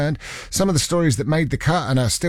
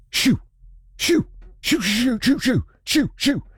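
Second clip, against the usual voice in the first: high-pitched, quick little shoos; shooing away; 10 semitones higher than usual. Pretend. Presenter pretending to shoo off a Russian warship, high-pitched shooing.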